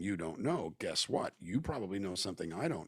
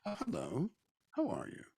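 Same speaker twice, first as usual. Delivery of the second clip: a hint of English lilt, pitched higher; English inflection; 8 semitones higher, 3 dB quieter than usual. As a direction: greet lightly in mock English. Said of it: "it's still the question".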